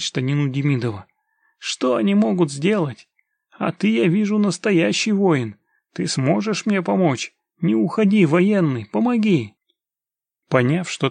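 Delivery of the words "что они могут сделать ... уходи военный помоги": high, insistent entreaty; desperate pleading. Acted. Father clings to the soldier, begging in a high voice. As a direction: beg him urgently to stay.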